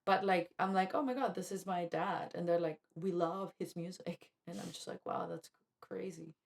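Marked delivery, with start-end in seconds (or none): none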